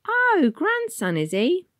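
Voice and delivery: very high, kind Cockney conductress; gentle London bus lady asking; asked kindly. Acted. Conductress to the boy Tom, high and kind.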